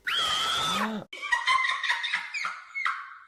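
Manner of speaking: shocked gasp. Real, not acted. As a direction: gasp in horror at the mirror. Grand reveal, shocked gasp.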